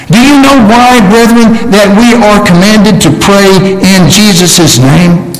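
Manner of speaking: normal talking voice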